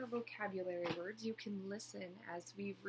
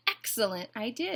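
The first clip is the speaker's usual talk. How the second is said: louder, approving cry; mild exclamation; 2 semitones higher, 10 dB louder than usual. A narrator's reading. Duck welcomes Goose's idea, mild exclamation. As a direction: exclaim it with a touch of delight. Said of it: "exclaimed Duck".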